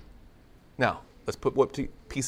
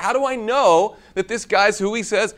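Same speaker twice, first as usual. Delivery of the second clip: doubting Nathanael; 8 semitones higher, 8 dB louder than usual. Retold or imitated. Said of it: "he couldn't get past that".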